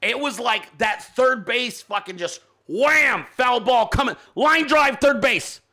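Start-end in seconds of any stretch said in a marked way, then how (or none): none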